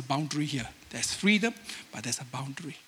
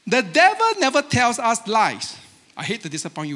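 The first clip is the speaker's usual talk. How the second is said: ordinary talk, nothing marked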